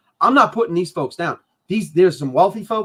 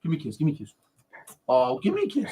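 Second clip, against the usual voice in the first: soft to the dog; 4 semitones lower, 8 dB quieter, faster than usual. Real, not acted. Asking dog for kiss.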